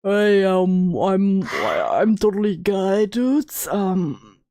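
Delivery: low, slow put-on dude voice; deep man voice. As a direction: badly fake being a guy, low and halting. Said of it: "doing a deep man voice or something".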